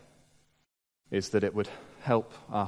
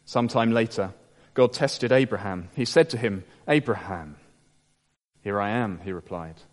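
scripture reading; 2 semitones lower than usual. Acted. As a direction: read the passage gravely, letting each phrase land. Preacher reads the Genesis passage.

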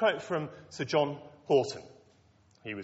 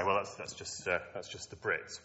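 a quiet, lower, dismissive mutter of a doubter; mock sceptic; 6 semitones lower, 7 dB quieter than usual. Preacher voicing an imagined listener, quietly and dismissively. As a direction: brush it off as a skeptic would. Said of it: "Oh, you might say".

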